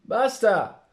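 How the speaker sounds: sharp, loud and high; angry shout. Acted. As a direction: yell it to make them stop. Ignacio snaps at sheep.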